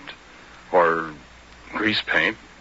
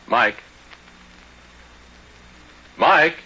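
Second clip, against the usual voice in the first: calling out; 8 semitones higher, 6 dB louder than usual. Acted. Calling out for his wife.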